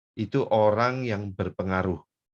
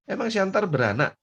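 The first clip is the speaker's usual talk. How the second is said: teasing friend; 11 semitones higher, 4 dB louder than usual. Retold or imitated. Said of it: "Katanya gitu".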